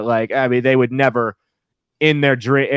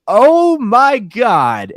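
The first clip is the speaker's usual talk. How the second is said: very slow, higher, louder stunned oh my God; shocked oh my God; 10 semitones higher, 6 dB louder, much slower than usual. Retold or imitated. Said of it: "I was like".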